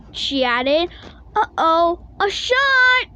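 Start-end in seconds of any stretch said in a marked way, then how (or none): 1.4-3.1 high, rising alarm; worried cubs. Acted. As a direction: dread of a needle. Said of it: "thought the cops".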